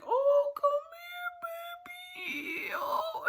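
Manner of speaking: high tender coo, breaking into tears. Retold or imitated. Comforting the little boy, tearful high coo. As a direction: coo to him like to a baby. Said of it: "And I'm like".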